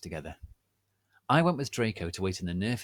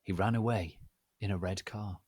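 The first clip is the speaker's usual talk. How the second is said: ordinary delivery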